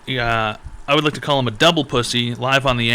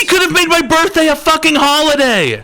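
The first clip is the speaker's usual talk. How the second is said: high, loud hammed-up fury; exaggerated comic rage; mock outrage; 6 dB louder than usual. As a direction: scream it way up high in fake disbelief. Outrage that holiday missed his birthday.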